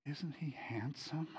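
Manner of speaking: slow, breathless whisper; admiring whisper. Retold or imitated. Wife admiring her transformed husband at church, whispering. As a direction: whisper it in awe of him. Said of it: "she's going like".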